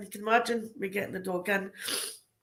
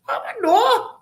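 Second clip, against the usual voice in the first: high bemused reply; 14 semitones higher, 7 dB louder than usual. Retold or imitated. Retold banter with prisoner.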